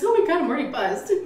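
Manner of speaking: high, fast quote of a tipsy mom; imitated tipsy mother. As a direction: mimic mom giddily admitting she's tipsy. Repeats tipsy mother's quote, higher.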